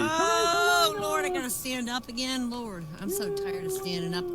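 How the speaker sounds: mock weary complaint. Real, not acted. Comic complaint about standing.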